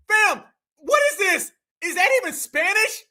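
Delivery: usual speaking voice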